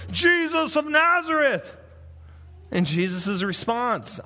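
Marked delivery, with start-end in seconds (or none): 0.1-1.7 the crowd yelling his name; mob shouting. Retold or imitated. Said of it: "they're shouting".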